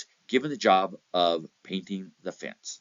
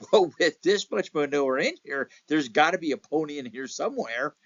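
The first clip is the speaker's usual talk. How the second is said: much higher, laughing with delight; gleeful child sure there's a pony in here; thrilled boy digging through manure, laughing; excited kid; 9 semitones higher than usual. Retold or imitated. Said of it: "he was so excited".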